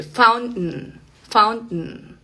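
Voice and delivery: "fountain" with the T stopped in the throat; glottal T. Shown as a demonstration. Teacher giving an example word, glottal T. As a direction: end "fountain" on a nasal n, T unreleased. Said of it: "Esa es una T glotal".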